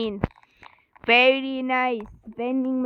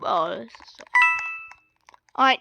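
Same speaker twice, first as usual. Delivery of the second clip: usual speaking voice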